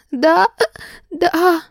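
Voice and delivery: высоко, всхлипывая и икая; плача, с икотой; сквозь икоту. A narrator's reading. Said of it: "добавилась ещё и икота". (сквозь слёзы и икоту)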